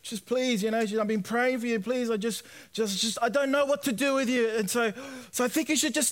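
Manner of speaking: a touch of mum breaking down, begging; mild imitated crying mum. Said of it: "my mum's kind of breaking down and she's crying".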